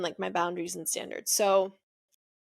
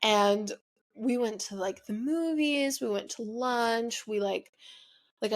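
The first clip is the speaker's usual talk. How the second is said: ordinary talk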